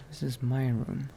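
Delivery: ordinary delivery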